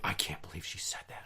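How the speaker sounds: hushed, low disapproval; imitated scandalized grandma. Strict grandma reacting to swearing, hushed mock shock. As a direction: mimic a shocked grandmother under the breath.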